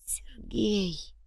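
very soft, high, fading name; hushed, drawn-out longing; grieving whisper. A narrator's reading. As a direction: breathe the name in grief. Girl whispers her lost man's name.

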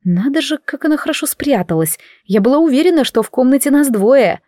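ordinary delivery

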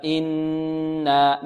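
"إنا" with a long hummed noon and two-count alif; slowly drawn "innaa", nasal hold then long vowel; ghunnah and madd. Shown as a demonstration. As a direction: hum the nn, then lengthen the aa of "إنا". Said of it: "مد الألف بمقدار حركتين هكذا".

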